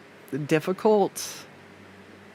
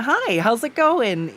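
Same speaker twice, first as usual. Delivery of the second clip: higher, slow and bright service voice; pitched-up greeting; 6 semitones higher, slower than usual. Shown as a demonstration. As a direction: lift the greeting up high and slow. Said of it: "I was all the way up here".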